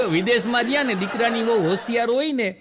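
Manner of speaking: ordinary delivery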